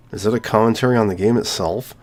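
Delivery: ordinary delivery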